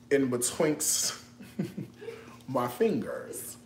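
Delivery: slow, breathy laugh through the words; real laughter slipping into the line; whispered giggle. Real, not acted. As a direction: giggle quietly while you say it. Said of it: "You know why I'm laughing?".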